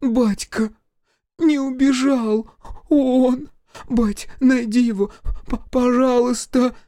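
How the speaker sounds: high sobbing plea; tearful begging. Acted. Bride begging her father through tears.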